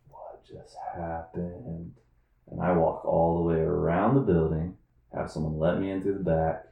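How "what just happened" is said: very slow, hushed disbelief; stunned. Retold or imitated. Coworkers left in shock, slow hushed amazement. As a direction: murmur it like a dumbstruck onlooker. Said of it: "everybody's just in shock".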